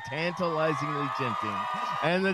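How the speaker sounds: loud, slow hype introduction; ring announcer. Acted. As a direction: announce them like fighters. Announces co-hosts like boxers.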